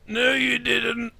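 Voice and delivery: high, slow, monotone put-down; drawn-out, deadpan old-teacher voice; addled old teacher. Acted. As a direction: drone it bluntly on a high note. Old teacher contradicts pupil.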